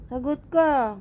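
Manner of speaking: high-pitched demand to answer; mock scolding. Owner scolds silent cat for an answer, mock scolding.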